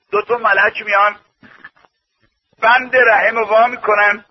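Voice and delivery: usual speaking voice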